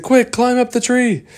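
high, loud urgent order; urgent shout. Acted. Lost Boys ordering the kids up the tree, high urgent shout. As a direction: shout the escape plan. Urgent command while fleeing.

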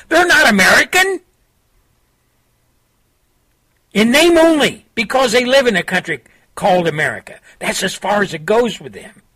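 high, pleading political monologue delivered to the nation; impassioned radio rant. Acted. Radio host urging listeners to wake up, impassioned pleading rant. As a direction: preach it like a warning broadcast.

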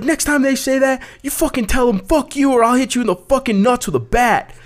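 high-pitched mock freak-out; imitated aggro rant. Aggro guy coaching his girlfriend by phone, high mock anger. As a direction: imitate an angry guy threatening over the phone. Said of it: "he's just aggro, freaking out".

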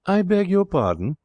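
high-pitched, affronted query; acted ladylike indignation, pitched well up; haughty query. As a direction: pull him up with icy politeness. Elsa coldly answers Martin.

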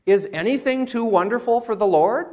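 high, loud rhetorical question; indignant challenge. Acted. The Lord challenging Abraham's household, indignant. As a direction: demand it, rising at the end.

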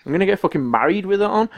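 high, insistent enthusiasm; over-excited boast. Retold or imitated. Answering a workmate's question about work, excitedly defiant. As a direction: replay a giddy, defiant boast.

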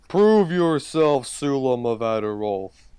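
slow, booming tree challenge; talking tree. Acted. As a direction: command them like an ancient guardian. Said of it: "And he goes".

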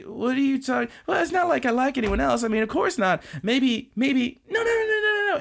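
high, rushed denial; flustered anime girl. Retold or imitated. Karen denying her crush.

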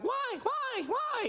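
put-on high squawking kids asking why; seagull-like whining kids. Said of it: "they're like".

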